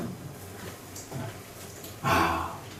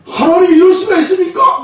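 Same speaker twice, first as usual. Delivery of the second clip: high, loud mock outcry; indignant protest; 14 semitones higher, 5 dB louder than usual. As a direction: shout an objection up to God, hammed up. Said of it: "막 반기를 드는 거예요". (항의하듯 높게 외치는 소리)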